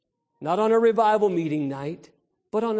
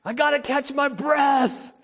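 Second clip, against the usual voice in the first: put-on gasping baby; 7 semitones higher, 4 dB louder than usual. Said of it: "kind of cry".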